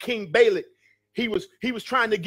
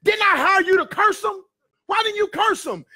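high, indignant shouted demand; acting out an angry king's outburst; mad king; 11 semitones higher, 5 dB louder than usual. King rebukes the seer he hired, mad. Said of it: "he was mad at Balaam".

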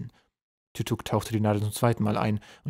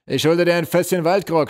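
loud, higher-pitched hail; calling down; 8 semitones higher, 9 dB louder than usual. A narrator's reading. General calls down to his hammerhead, loud hail. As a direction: project it down to someone below. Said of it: "rief zu einem Hammerkopf hinunter".

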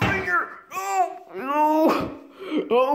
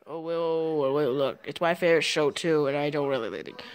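much lower, subdued character voice; low grumbling character; 11 semitones lower than usual. Acted. Character claiming the favorite show too, low grumble. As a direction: drop the pitch and answer reluctantly. Second character answers excited fan.

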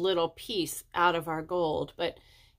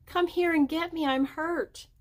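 put-on higher, small voice of a hurt girl; tiny voice; 9 semitones higher than usual. A narrator's reading. Hurt girl calling up from the cave, tiny high voice. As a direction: sound small and far below. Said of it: "we heard a tiny voice".

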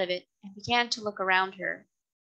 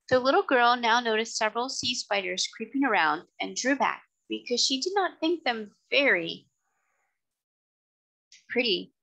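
usual speaking voice

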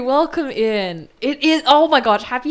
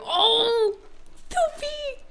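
very high, melting squeal; deeply touched; 12 semitones higher, 5 dB quieter, much slower than usual. Real, not acted. Streamer receiving a sweet message, touched. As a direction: go high and soft, overcome by kindness.

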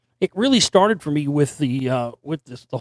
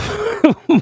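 laugh; 13 semitones higher, 4 dB louder than usual. Real, not acted. Laughs at own impression.

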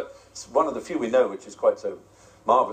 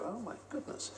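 awed whisper; 10 semitones higher, 15 dB quieter than usual. Retold or imitated. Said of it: "you'll go".

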